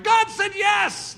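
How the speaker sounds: slow, high, loud triumphant call; triumphant shout. Retold or imitated. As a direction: shout the good news slowly. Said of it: "the next person's like".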